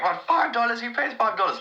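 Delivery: mock outrage.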